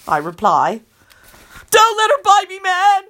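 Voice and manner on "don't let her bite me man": high panicked howl. Acted. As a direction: howl it out in terror. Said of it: "Kenny howls".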